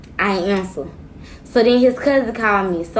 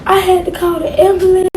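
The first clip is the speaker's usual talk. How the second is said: put-on whiny boyfriend; 9 semitones higher, 4 dB louder than usual. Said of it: "He talking about".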